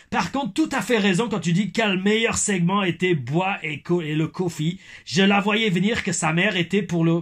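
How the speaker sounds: mock Québécois that drifts German; Québécois accent turned German. Reading a fan's comment, Québécois accent slipping into German. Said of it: "j'ai un accent allemand".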